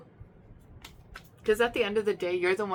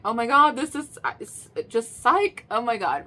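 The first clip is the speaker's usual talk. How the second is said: giddy, breathless, higher and louder burst; mock amped-up excitement; 4 semitones higher, 6 dB louder than usual. Reader mimics someone over the moon. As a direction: gush like you just won everything. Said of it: "Being super excited and amped out of your mind".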